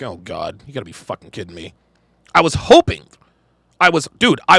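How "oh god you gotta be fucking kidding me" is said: very soft, fast under-the-breath groan; muttered dismay. Retold or imitated. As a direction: mutter it to yourself, barely audible. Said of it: "I'm like".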